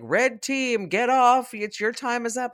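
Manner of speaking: loud, high call across a crowd; acted announcement, belted out; imitated staff shout. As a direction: holler like staff clearing kids out. Staff calling out, retold.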